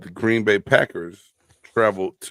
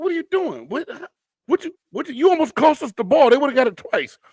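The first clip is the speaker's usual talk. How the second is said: high, exasperated coach yelling at his player; mock angry coach; 5 dB louder than usual. Coach to player after the coin toss, high exasperated yell. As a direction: play the head coach losing it, pitched way up. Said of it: "he's like".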